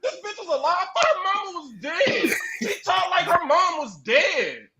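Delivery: retold disbelief. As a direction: act out your stunned outrage. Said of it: "I was like".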